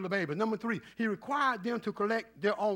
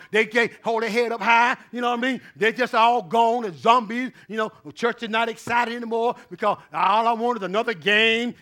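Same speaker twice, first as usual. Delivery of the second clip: loud rhythmic sermon, rising at the peaks; fired-up preaching; 2 semitones higher, 7 dB louder than usual. Acted. Pastor warning the congregation that Satan wears them out, in loud rhythmic preaching. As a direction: drive it home to the pews.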